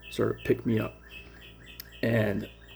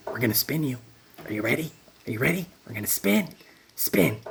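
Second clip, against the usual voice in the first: eerie taunting voices; 4 semitones higher, faster than usual. Retold or imitated. Voices in head taunting him.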